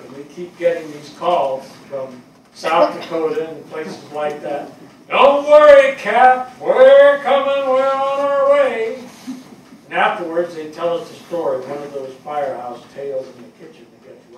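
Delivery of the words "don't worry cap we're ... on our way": high, loud, drawn-out reassurance; calling out loud. Retold or imitated. Firefighter acting Barney and Dave calling the captain, a loud, high shout. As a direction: shout it down a bad phone line to the boss.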